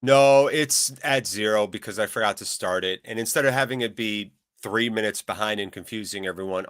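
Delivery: ordinary talk, nothing marked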